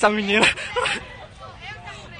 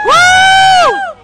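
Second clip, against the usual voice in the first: loud whoop; 11 dB louder than usual. Real, not acted. Crowd cheer.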